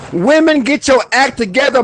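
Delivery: fired-up command to women; with emotion. Shown as a demonstration. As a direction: demand it with heat. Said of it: "Put some emotion in".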